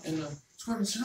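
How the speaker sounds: ordinary delivery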